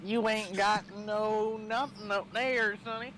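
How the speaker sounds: folksy Southern cowboy; Southern drawl. Acted. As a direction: tease in a drawn-out Southern accent. Mock-Southern retort to English friend.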